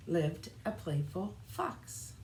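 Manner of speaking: usual speaking voice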